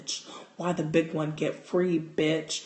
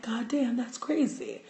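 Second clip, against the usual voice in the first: lightly voiced, higher amazed exclamation; a hint of stunned disbelief, pitched up; mock amazement; 7 semitones higher than usual. Retold shocked reaction to mouse.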